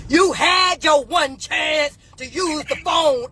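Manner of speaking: high, slow mock shout; imitating a yelling guard. Guard refusing a second phone call, imitated slow shout. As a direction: bark it like a jail guard who yells everything. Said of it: "she just was yelling everything".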